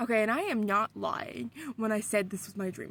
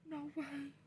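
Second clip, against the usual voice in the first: soft, higher, stunned little voice; gasp, then barely get it out; retold hushed disbelief; 5 semitones higher, 16 dB quieter than usual. Retold gasp as friend appears.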